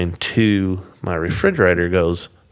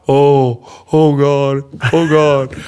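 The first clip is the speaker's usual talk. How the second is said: loud, raised mock-horror exclamations; horrified dismay; 6 semitones higher, 6 dB louder, much slower than usual. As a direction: imitate a friend aghast at disaster. Friend sees the flood, retold.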